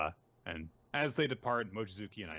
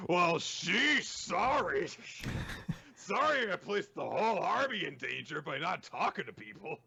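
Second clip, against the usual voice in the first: high, loud sarcastic apology; hammed-up sorry, protesting and whiny; mock apology; 9 semitones higher, 4 dB louder than usual. Acting an over-the-top apology.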